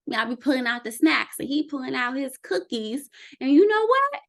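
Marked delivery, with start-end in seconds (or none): 3.4-4.2 high, loud pep-talk; rally the viewer like a cheerleader; excited pep. Acted. Coach cheering viewer on against the cookies, excited.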